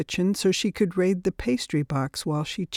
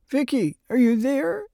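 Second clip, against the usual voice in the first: higher-pitched call, rising at the end; anxious calling; 7 semitones higher than usual. Acted. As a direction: check with mild worry that someone is listening. Caller checks she is still there.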